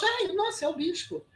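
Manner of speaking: soft, high little exclamation; mock awe. Guest replays seeing the bishop from afar, mock awe. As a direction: imitate a far-off onlooker's hushed excitement.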